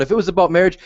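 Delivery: ordinary talk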